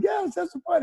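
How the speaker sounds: high, cheery party call; excited. Retold or imitated. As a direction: sing it out, ready to party. Band answering fans cheerfully.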